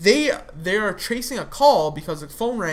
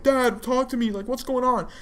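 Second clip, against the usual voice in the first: higher-pitched mock panic; freaking out; 6 semitones higher than usual. Walt Junior pleading with his dad from the background, imitated freak-out. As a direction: plead in a raised pitch, imitating a frantic son. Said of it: "freaking out still".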